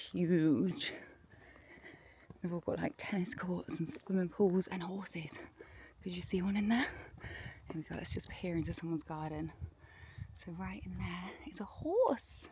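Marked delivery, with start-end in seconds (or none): 0.1-7.8 whispering. Real, not acted. Peering at neighbours' houses. 10.3-12.4 whispering. Real, not acted. Peering into someone's garden.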